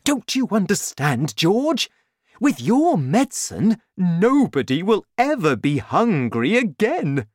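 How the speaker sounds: high, loud, sweeping enthusiasm; very excited father. A narrator's reading. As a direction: proclaim it to your son with big swoops. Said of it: "He was very excited now".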